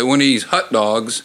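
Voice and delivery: usual speaking voice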